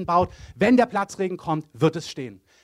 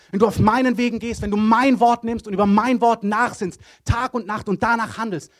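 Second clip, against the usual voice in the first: raised, high-pitched, emphatic sermon delivery; full-force preaching; 5 semitones higher, 7 dB louder than usual. Real, not acted. Preacher urging listeners to follow God's word, fervent and loud. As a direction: drive each condition home loudly.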